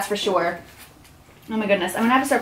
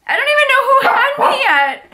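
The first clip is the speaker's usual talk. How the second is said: emotional rush; 12 semitones higher, 3 dB louder, faster than usual. Real, not acted. Emotional over gift.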